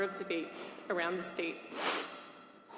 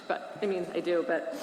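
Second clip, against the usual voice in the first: normal talking voice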